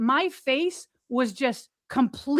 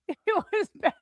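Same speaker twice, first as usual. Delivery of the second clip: it was bad, said through a laugh and pitched higher; 7 semitones higher than usual. Real, not acted. Summing up the near-suffocation to the host, laughing. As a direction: laugh off the scare.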